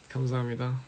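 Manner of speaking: ordinary talk, nothing marked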